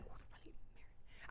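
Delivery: hushed disbelief, acted; aghast whisper. Reader reacting to the wedding, aghast whisper. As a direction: whisper it as a scandalised aside.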